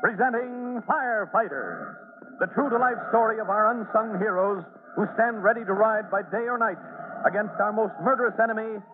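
announcer intro. Acted. Announcer presenting the show to listeners, dramatic read. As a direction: sell the heroes like a show opener.

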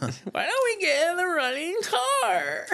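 squeaky high, slow mock panic; mock horror victim. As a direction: whine it like a scared teen in a slasher. Mocking horror movie character.

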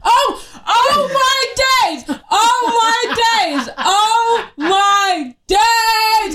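high, drawn-out, sing-song outrage; exasperated wail. Real, not acted. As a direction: wail each word out slowly, getting worked up. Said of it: "I'm gonna get mad".

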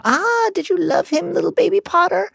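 very high, cooing sneer; mock baby voice. A narrator's reading. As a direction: croon it cruelly like to an infant. Bellatrix mocking Harry's grief.

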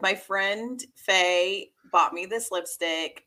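normal talking voice

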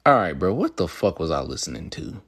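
ordinary delivery

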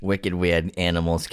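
put-on light Boston accent, dropped r. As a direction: say it like a Bostonian local. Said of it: "a little Boston accent in there".

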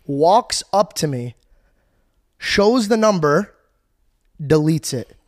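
slow, punched phrases with pauses between; dramatic beat-by-beat telling. Real, not acted. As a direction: land each step like a reveal. Dramatic climax of the story.

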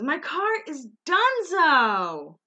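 slow, high, loud wail of "My car is Dunzo!"; quoting Kristen. Retold or imitated. Recapping the reality show, Kristen wails about her car. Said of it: "She goes".

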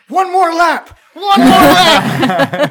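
high, loud laughing shout; screaming back. Acted. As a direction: scream it back over the shopkeeper. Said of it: "Dak screams back in Mandarin".

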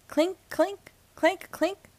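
high, light clinking sound; high-pitched sing-song clinks; imitated clinking. A narrator's reading. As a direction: chime the words like coins. Narrator imitates the heard noise.

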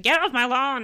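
mock grumpy shout. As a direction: play the grumpy ghost shooing kids off. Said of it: "shouting at kids".

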